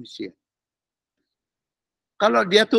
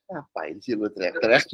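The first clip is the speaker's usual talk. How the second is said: Jesus unimpressed by shouting; 9 semitones lower, 5 dB quieter than usual. Retold or imitated. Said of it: "Tuhannya datang".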